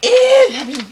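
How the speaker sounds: squeaky mock of a scolding listener; hammed-up high mock voice. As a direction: pitch way up and hammed, like a nosy judge. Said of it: "you're probably like".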